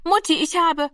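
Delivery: put-on high, eager kids calling out over each other; joyful children's cries. Children showing their Christmas presents to their parents, high excited calls. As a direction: call out like children thrilled by presents. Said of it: "Von allen Seiten klangen fröhliche Rufe".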